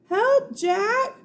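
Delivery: high, loud shout for help; high shouted cry for help. Acted. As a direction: scream from far away for help. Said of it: "Her cry seemed fainter".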